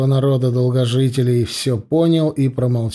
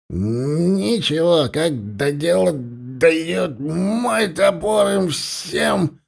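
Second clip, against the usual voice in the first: put-on drunken boast; 4 semitones higher, much slower than usual.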